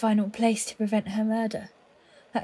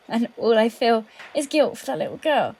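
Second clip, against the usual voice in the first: ordinary talk, nothing marked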